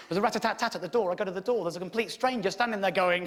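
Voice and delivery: ordinary delivery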